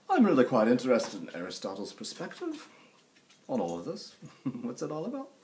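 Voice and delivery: hammed-up, high-pitched British accent; terrible British accent. Student speaking up about Aristotle, mock British accent. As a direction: put on a bad British accent. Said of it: "with a British accent".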